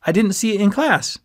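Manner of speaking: high, loud and eager; lively greeting. A narrator's reading. Friend greeting a classmate on the couch, high and lively. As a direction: keep the boisterous buddy energy. Ski's excited greeting continues.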